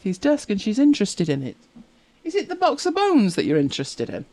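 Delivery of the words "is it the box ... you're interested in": high pet talk. Real, not acted. Asking her dog.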